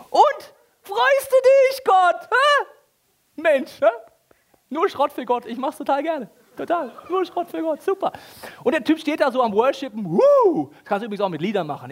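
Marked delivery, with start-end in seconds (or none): 0.1-3.9 very high, loud, slow nagging; pushy shepherd. Acted. Shepherd pestering God. 4.7-8.1 high mock cheerful sarcasm; sarcastic cheer. Mocking cheap worship. 10.1-10.7 very high loud whoop; mock worship whoop.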